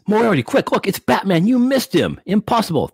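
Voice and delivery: high panicky goon voice; squeaky henchman. Acted. Goon warning Moriarty that Batman is back, high and panicky. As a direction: yelp it like a startled henchman.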